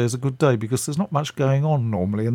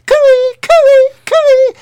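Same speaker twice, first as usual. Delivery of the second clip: much higher, louder put-on sing-song call of an elderly actress; Patricia Hayes calling out; 12 dB louder, much slower than usual. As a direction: hail the policeman up high and loud. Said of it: "and she's going".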